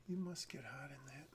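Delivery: soft whisper, rising on the start; whispered line. Shown as a demonstration. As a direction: breathe the line like a question. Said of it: "This doesn't really sound like a question".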